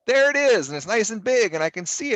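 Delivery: high, loud mock delight; delighted reaction. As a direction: exclaim it as a happy reveal. Acting delight at a readable snip.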